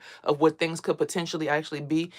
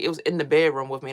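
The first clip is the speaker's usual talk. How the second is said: ordinary talk, nothing marked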